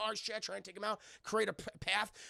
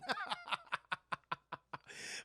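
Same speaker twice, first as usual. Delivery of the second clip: long, staccato trailing laugh; high, quiet run of chuckles; laughter; 6 semitones higher, 5 dB quieter than usual. Real, not acted. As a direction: crack up at your own joke. Laughing at own joke.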